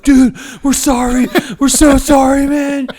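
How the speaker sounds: high, loud, squeaky pleading apology; panicky apology. Retold or imitated. Caught kids apologize to the driver in a high panicky plea. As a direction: beg forgiveness in a panicked squeal.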